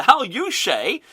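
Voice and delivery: put-on slow, high Dutch accent; mock Dutch accent. Host parodying manager's catchphrase, mock Dutch accent. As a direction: stretch it out in fake Dutch-English. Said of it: "At least he's not saying there".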